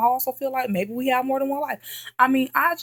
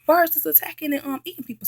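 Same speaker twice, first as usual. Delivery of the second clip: urgent explaining; 8 semitones higher than usual. Retold or imitated. Telling dad on phone.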